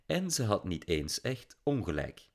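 ordinary delivery

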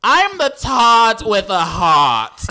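slow, high, loud mock British accent; playing a drag queen in a British accent. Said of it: "Gonna try to be British".

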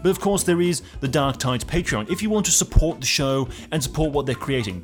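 loud, bright sponsor pitch; cheery podcast promo voice; upbeat ad read. Acted. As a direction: sell it like a radio host. Patreon ad read over music.

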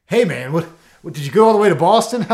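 loud, high-pitched mock incredulity; teasing dad joke. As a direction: call it out big, relishing your own joke. Delivering his teasing dad joke.

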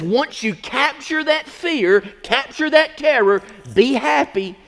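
high, loud, rising pulpit preaching; preaching at full force. Acted. Pastor rallies brothers and sisters against the devil, shouting. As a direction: fire up the church, building to a shout.

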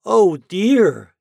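high, loud storybook dismay; dismayed cry. A narrator's reading. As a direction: cry out like a worried little pumpkin. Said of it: "he cried".

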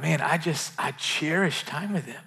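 whispered to himself; hushed inner thought. Retold or imitated. Pastor recalls thinking of his siblings, whispered. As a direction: breathe it out like a private thought.

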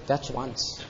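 wife's icy calm reply to the husband; calmly. Retold or imitated. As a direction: say it coolly, a quiet threat. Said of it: "she looked at me calmly and she said".

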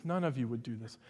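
muttered teasing repeat; quiet aside. Real, not acted. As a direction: drop it under your breath as a joke.

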